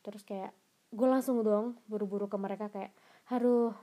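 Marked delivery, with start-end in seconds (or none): 3.3-3.7 relieved sigh. Retold or imitated. Relieved to find her friends.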